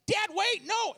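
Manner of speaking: high loud urgent yell; yelling daughter. Retold or imitated. Daughter in back seat yells at dad, high urgent. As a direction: shriek it like a panicked kid. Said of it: "my daughter yells".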